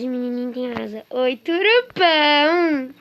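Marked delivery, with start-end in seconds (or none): 1.1-2.9 slow, high, loud cooing; stretched-out squealing coo; baby talk. Acted. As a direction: gush over a cute creature. Baby talk to cute character.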